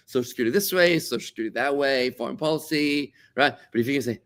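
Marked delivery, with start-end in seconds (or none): none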